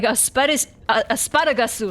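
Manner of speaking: "asparagus" as ah-spa-da-ga-su, r tapped; stumbling into Japanese-style asparagus; Japanese pronunciation. Retold or imitated. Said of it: "how you'd say it in Japanese".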